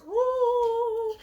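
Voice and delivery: high startled little "oh"; a tiny mummy yelping in shock. Retold or imitated. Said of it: "He's like".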